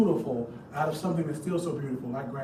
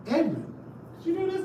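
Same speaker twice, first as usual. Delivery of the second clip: master asking; 12 semitones higher, faster than usual. Retold or imitated. Master questions child.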